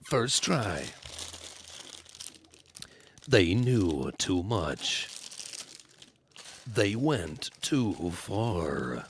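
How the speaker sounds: low, slow brooding superhero narration; Batman voice. Pretend.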